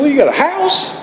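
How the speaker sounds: very high, teasing singsong question; smiling God. Retold or imitated. God naming his next demand, smiling and teasing. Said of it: "God smiled again".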